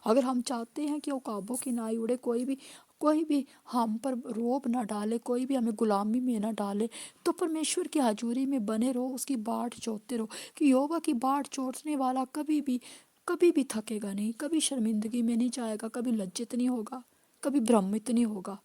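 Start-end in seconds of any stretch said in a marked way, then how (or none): none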